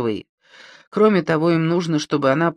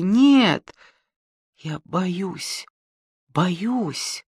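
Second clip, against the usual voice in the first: испуганно; 4 semitones higher, much slower than usual. Acted. Маня в страхе.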